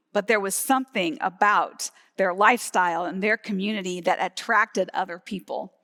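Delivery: ordinary talk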